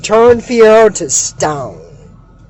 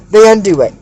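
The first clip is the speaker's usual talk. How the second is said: ordinary delivery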